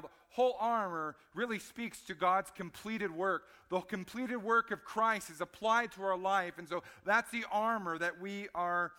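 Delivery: loud, high, driving sermon voice; full-force preaching. Acted. Pastor urging the congregation to take up armor, loud and driving. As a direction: preach it hard to the pews.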